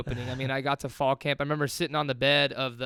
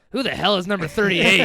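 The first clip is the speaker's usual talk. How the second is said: loud, high mock shout of a baffled coach; imitated coach yell; 5 semitones higher, 11 dB louder than usual. Coach Leach spotting the unknown freshman, mock shouting. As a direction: bellow it across the sideline.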